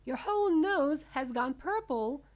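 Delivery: high, loud alarmed father; shout. A narrator's reading. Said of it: "shouted Mister Beauregard".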